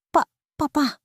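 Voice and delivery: high, faltering call for her father; a frail daughter's stammered cry; weak broken cry. A narrator's reading. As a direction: gasp it out from a sickbed, barely holding on. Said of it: "Ang tanging naibulalas ni Elena".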